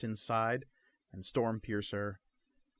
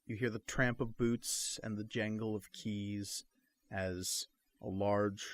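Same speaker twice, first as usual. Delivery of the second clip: usual speaking voice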